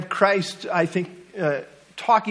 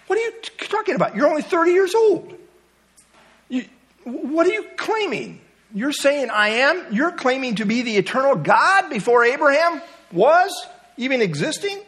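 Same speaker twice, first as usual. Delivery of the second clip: much higher, indignant disbelief from the crowd; incredulous outrage; 8 semitones higher than usual. Retold or imitated.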